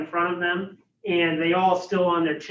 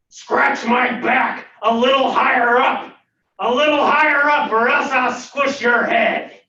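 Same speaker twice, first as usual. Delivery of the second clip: loud, high-pitched angry bellow; angry monster voice; 8 semitones higher, 9 dB louder than usual. Acted. Unseen voice up the chimney demanding a scratch, angry bellow. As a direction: bellow an impatient threat like a cranky monster. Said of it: "a very angry sounding voice".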